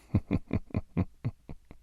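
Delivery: hushed gloating laugh. Acted. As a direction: chuckle to yourself at his helplessness. Villain gloats over captive.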